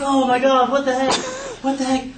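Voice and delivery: high, loud acted horror; horrified panic. Kids watching brother get swarmed, horrified. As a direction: exclaim high in alarm.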